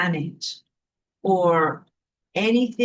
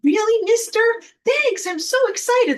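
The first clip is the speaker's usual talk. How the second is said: put-on high, gushing starstruck boy voice; thrilled young kid thanking the man, voice way up high; excited kid; 3 dB louder than usual. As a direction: squeal it like a thrilled little kid. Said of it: "You'd be like".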